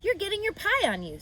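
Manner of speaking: a little sister pointing out a mess; Fern, high and prim; Fern tattling. Acted. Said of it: "said Fern".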